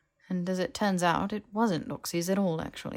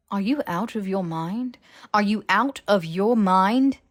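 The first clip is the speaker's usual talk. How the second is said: rising, louder disbelief; alarmed outburst; 3 semitones higher, 9 dB louder than usual. Acted. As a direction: blurt it out louder each time.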